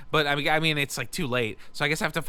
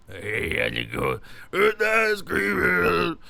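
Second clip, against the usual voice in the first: growled, slow rasp breaking up louder; 8 semitones higher, 6 dB louder, much slower than usual. Acted. Wishing smoking gave that voice, exaggerated growly impression. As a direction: growl it out from a wrecked throat. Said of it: "I wanna sound like [name]".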